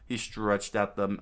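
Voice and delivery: usual speaking voice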